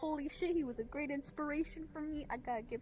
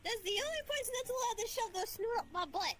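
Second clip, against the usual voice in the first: indignant shout; 7 semitones higher than usual. Acted. Indignant outburst continues.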